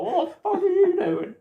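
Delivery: high-pitched imitation of an outraged dad. Dad pulling his child up, mock outrage. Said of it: "My dad, like, pulled me up and was like".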